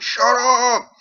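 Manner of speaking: very high, loud, drawn-out shout; exasperated yell. A narrator's reading. Ditch imagines silencing the cellist, exasperated yell. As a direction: scream it at the show-off.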